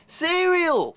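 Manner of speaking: high announcing shout; triumphant cereal. Acted. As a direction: announce it like the winning answer. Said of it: "What's the first thing every fucker has".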